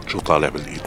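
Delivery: low, soft repeat of the question; hollow, defeated echo; bitter echo. Acted. Bitterly echoes his friend's words. (صدى مرير منخفض)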